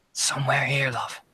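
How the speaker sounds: secretive whisper, breath only. Acted. NPC hunting the noise, whispering to the girl. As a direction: hush it like a secret.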